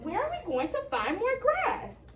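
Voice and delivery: high anxious goat voice; worried middle goat. Acted. Middle goat asking his brothers, high and anxious. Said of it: "asked the middle brother".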